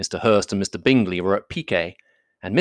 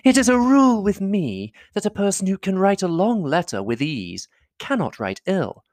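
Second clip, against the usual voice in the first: high-pitched, fussing society lady; effusive lady admirer, pitched high; gushing flatterer; 9 semitones higher than usual. A narrator's reading. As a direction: fawn over him in a high, swooping voice. Voices Miss Bingley complimenting Darcy.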